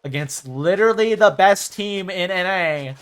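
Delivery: loud, drawn-out mock trash talk; mock yelling. Streamer imitating a player yelling across the stage, mock yell. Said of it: "I'm gonna yell across the stage".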